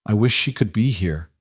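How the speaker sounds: usual speaking voice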